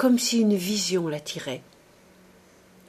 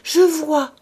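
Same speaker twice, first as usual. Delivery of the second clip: high, loud cry of wonder; cried out; 9 semitones higher, 9 dB louder than usual. A narrator's reading. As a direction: shout it as if sight has just come. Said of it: "cria-t-elle".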